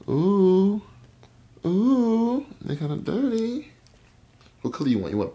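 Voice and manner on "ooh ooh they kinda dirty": high, teasing ooh; playful ooh. Real, not acted. Dad teasing his daughter about dirty nails, high-pitched. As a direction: tease her gently, pitched way up.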